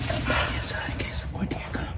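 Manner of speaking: hushed hunting whisper. Real, not acted. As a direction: keep it to a whisper in the blind.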